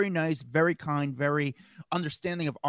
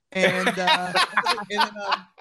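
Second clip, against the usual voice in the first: laughing; 3 semitones higher, 6 dB louder, much slower than usual. Real, not acted. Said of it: "laughing at own story".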